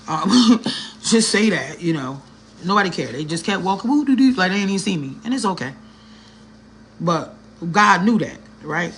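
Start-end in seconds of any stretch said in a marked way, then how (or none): none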